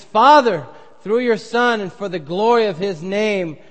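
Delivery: loud, high call to the Father; impassioned prayer. Acted. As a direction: lift your voice to God.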